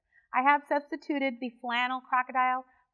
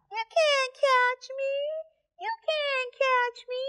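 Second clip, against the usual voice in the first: very high, slow taunting monkeys; squeaky sing-song taunt; teasing monkey voice; 13 semitones higher than usual. Acted.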